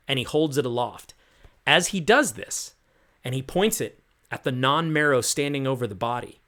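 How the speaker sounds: ordinary talk, nothing marked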